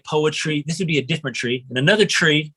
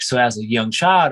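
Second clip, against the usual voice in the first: normal talking voice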